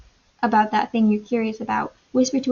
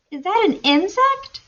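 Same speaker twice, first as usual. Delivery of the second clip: amazed question; 9 semitones higher than usual. A narrator's reading. Amazed child in story.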